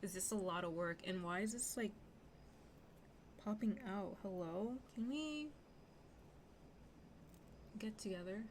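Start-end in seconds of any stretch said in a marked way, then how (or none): none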